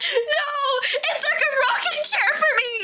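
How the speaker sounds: very high, wailing protest; hammed-up squeaky insistence; whining panicked friend. Panicking friend's retold answer.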